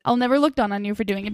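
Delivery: normal talking voice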